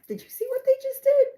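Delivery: very high, disbelieving; can you believe it, pitch soaring; shocked outsider. Retold or imitated. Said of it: "that extra set of eyes to tell you".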